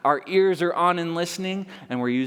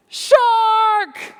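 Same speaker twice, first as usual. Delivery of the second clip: very high, loud shout of "Shark!"; gigantic shout; 8 dB louder than usual. A narrator's reading. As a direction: blast the alarm. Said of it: "came the gigantic shout".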